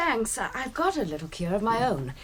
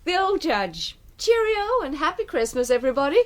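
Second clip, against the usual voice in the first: higher and louder, a bright festive toast; cheery toast; 6 semitones higher, 5 dB louder than usual. Acted. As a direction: raise your glass and cheer the room.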